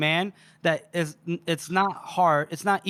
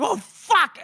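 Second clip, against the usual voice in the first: loud, high-pitched panicked curse; mock alarm; 13 semitones higher, 5 dB louder than usual. Swordsman sees the powder lit, acted loud high alarm. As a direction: yelp it as a hammed-up realisation.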